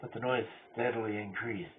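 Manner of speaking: rising from loud telling to screaming; escalating hysteria, shouted; frantic building shout. Acted. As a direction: voice climbing into a mad, panicked shout. Climax of mad narrator's frenzy.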